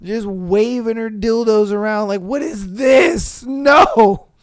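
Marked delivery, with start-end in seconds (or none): 2.2-4.3 high, slow, loud question then a sharp no; hammed-up disgusted parent; mock appalled shout. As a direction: recoil from the thing. Parent waving dildos, appalled.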